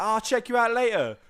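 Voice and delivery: high, loud put-on voice; hammed-up cheery goodbye; mock breezy stranger. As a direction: brush them off brightly. Stranger signing off.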